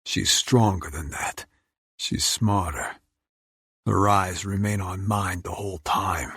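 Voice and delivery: plain reading voice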